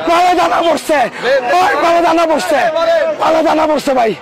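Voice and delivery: loud hawker's call, belting out the goods; street vendor shouting. Real, not acted. Street vendor calling out his melons to passing buyers, loud shouting. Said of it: "গলা ফাটিয়ে চিৎকার করে".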